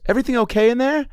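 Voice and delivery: high, fast sing-song call; hammed-up stewardess calling through the door; flight attendant. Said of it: "she knocks".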